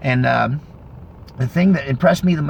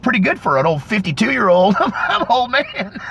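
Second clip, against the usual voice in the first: higher, tickled boast breaking into laughter; laughing self-mockery; 8 semitones higher than usual. Real, not acted. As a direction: joke on yourself and laugh through it. Jokes about his age, laughing.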